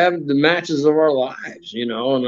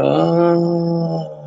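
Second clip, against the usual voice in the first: long, drawn-out "oh", pitched up; imitated exhaling groan; 5 semitones higher than usual. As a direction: mimic someone leaning back and letting out an "oh". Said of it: "breathe out".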